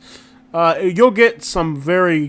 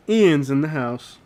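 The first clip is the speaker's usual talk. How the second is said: ordinary delivery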